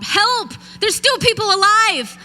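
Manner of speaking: loud, high call for help; hollering for help. Retold or imitated. Trapped survivor calling to rescuers, loud high holler. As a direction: shout out so rescuers can hear. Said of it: "and I holler out".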